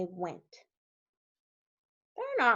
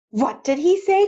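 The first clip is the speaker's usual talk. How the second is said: high, loud shocked question; astonished outcry; frogs crying out; 7 semitones higher, 6 dB louder than usual. A narrator's reading. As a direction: cry it out in disbelief. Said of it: "cried the frogs".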